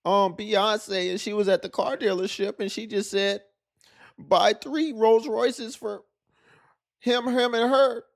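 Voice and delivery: high-pitched mock voice of an envious woman; whiny woman impression. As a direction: ham up an entitled complainer. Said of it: "she gonna be like".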